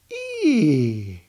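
high, sudden little-deer squeal; a startled young deer crying out; excited deer squeal. A narrator's reading. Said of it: "he dances around and he jumps".

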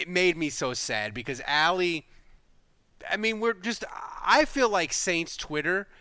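usual speaking voice

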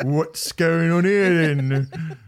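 slow Cockney copper; old-school policeman voice. Acted. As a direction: drawl it like a beat bobby. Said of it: "an old school one with a truncheon".

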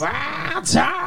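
high cartoon prospector voice; Yosemite Sam. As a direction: yelp it like a cartoon cowboy. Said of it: "that voice that you're doing".

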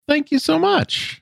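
high, drawn-out grateful thanks; gushing thanks. Real, not acted. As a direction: gush your gratitude slowly.

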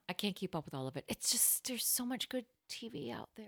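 very soft, breathy, intimate; hushed whisper. Pretend. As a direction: lean in and murmur softly.

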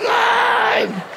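high yelp of surprise; dad's startled cry. Retold or imitated. Dad caught in a headlock, high startled yelp. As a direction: yelp like you've been grabbed. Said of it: "He's like".

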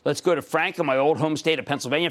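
ordinary delivery

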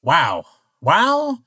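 glitch from wow into stuttering whoops; stunned disbelief. Acted. Robot stunned at failed joke.

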